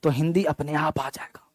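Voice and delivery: soft whispered aside; hushed reassuring whisper. Real, not acted. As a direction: whisper it like a secret tip. Teacher drops to a whisper.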